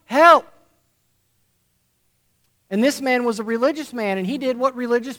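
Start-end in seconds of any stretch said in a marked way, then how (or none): 0.0-0.5 loud, high call for help; man in the pit yelling to a stranger; screaming for help. Acted. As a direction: shout up out of the hole. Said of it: "he started to scream out".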